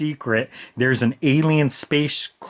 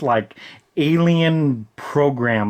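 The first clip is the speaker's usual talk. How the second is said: ordinary talk, nothing marked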